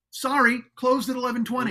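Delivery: sarcastic store clerk voice; mock sign voice. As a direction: sneer it out like a smug store clerk. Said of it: "And it says".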